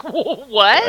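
taken aback, playing it up; mock disbelief. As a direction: act out a startled what. Retells reaction to accent remark.

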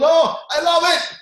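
high, loud hammed-up scream; mock scream while sprinting. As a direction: scream it out mid-sprint, half crying. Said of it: "now he's screaming as he's sprinting".

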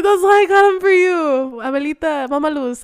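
high, loud, amused mock plea; pleading grandkid. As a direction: play a kid earnestly explaining to grandma, on the edge of laughter. Acts child pleading with grandma, laughing.

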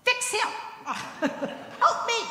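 high, laughing plea of a worn-out mom; mother begging for help with her son; frantic mother. Retold or imitated. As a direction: squeak it out like a mother at her wits' end. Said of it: "I could imagine her going".

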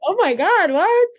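retold shock, loud and shooting up high. Athlete recalls seeing her team's style ranking, shocked. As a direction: exclaim it high in amused disbelief. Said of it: "I was shocked when I saw it".

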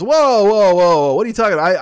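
loud, high balking client imitation; mock alarm. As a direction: cry out as if shocked by the price. Client balks at the artist's price.

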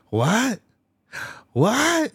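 high squeaky disbelieving what; shocked what. Retold or imitated. Imitating Pee-wee at the magic shop, shocked high squeak. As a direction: yelp it out high and loud, stunned.

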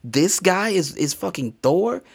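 stunned high exclamation; amazed. Real, not acted. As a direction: blurt it out in amazement. Amazed at actor being Thor.

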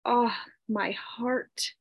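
very soft, slow sigh; hushed, aching lament. A narrator's reading. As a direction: let each "oh" sink out quietly, with long pauses. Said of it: "She sighed".

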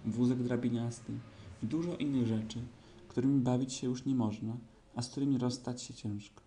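usual speaking voice

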